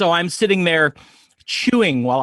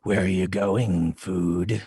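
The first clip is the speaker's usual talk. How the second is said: predator voice; 8 semitones lower, slower than usual. Acted. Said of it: "spin around like".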